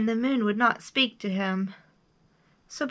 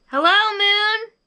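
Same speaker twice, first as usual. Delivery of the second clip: high, loud call; big high-pitched shout; 11 semitones higher, 8 dB louder than usual. A narrator's reading. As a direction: call up to someone very far away. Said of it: "he shouted".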